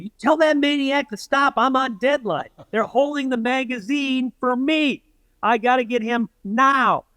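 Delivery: urgent and high-pitched, voiced as a panicking reporter; frantic deadline panic. Reporter complaining in the storyteller's ear about his deadline, frantic.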